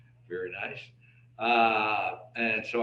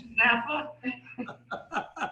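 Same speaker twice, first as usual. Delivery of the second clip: laughing through a joking question; chuckling as he asks; 10 semitones higher, slower than usual. Real, not acted. Joking about smoke signals.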